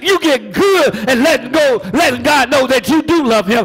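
high, loud sung preaching over music; preacher whooping at full force. Acted.

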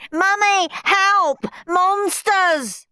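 very high, loud cries for help; panicked screaming. A narrator's reading. Steve fleeing to his mother, panicked screaming. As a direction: shriek in terror for rescue.

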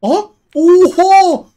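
loud, high, stretched exclamation; shocked cry; surprised shout. Real, not acted. Sees meat coming out.

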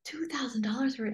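whispered doubtful gremlin; gremlin brain. Retold or imitated. Coach voicing the inner gremlin's doubt in a whisper. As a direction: hiss it like a sneaky inner gremlin. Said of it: "that little gremlin brain of yours is gonna be like".

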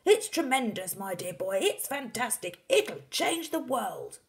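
high, exclaiming father voice; Mister Cranky, excited. A narrator's reading. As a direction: proclaim it with wild enthusiasm. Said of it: "cried Mister Cranky".

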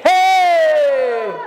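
loud high cry of joy; shout. Real, not acted. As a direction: shout it out to the congregation. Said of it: "Let them shout for joy".

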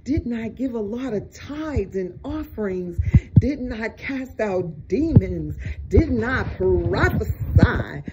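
high, wailing mock plea to the Lord; crying out in mockery. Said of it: "When they cry out".

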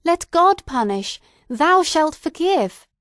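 high, loud impassioned outcry; desperate cry. A narrator's reading. Hester flinging herself beside him, desperate insistent cry. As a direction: cry out with full force, drawing out the demand. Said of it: "cried Hester".

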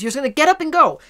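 sudden loud, high burst of energy; excited burst. Acted. Aries energy kicks in.

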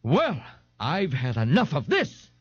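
pompous, measured outrage; angrily. A narrator's reading. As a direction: draw it out in offended anger. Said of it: "Ignatius said angrily".

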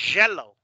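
YELLOW said as "jello", loud and falling; hard J. Shown as a demonstration. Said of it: "también existe la palabra".

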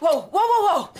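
high and loud, stopping him in his tracks; a loud, high protest cry of wow; alarmed wow. Acted. Wife stopping her husband at the door, alarmed and loud.

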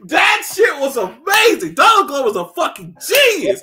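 high, loud laughing rave; real loud excitement; excited raving. Real, not acted. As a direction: gush loudly, still laughing. Raving about the show.

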